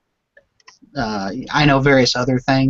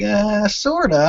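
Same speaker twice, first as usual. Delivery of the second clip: higher-pitched mock hedging; imitated hesitant answer; 7 semitones higher, slower than usual. Host teasing guest about languages spoken, imitated hesitation. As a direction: play an unsure reply, pitched up. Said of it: "You're like".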